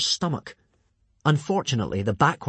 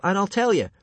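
high, louder promise; urgent bargaining; 9 semitones higher, 5 dB louder than usual. A narrator's reading. Hiccup negotiating with the strangulator, high coaxing voice. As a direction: dangle the offer brightly.

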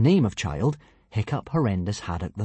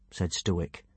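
ordinary delivery, nothing marked

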